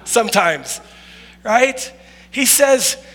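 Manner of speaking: ordinary delivery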